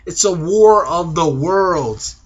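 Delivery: slow, drawn-out movie-trailer line; milk the pun slowly; slow mock-epic announcement; dramatic pun delivery. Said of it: "See what I did there?".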